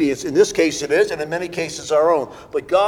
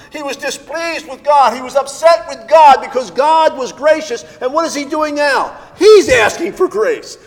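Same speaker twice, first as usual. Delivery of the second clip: high, building pulpit indictment, growing louder; forceful preaching; 5 semitones higher, 6 dB louder than usual. Real, not acted. Preacher listing Jonah's rebellion to the congregation, preaching with mounting intensity.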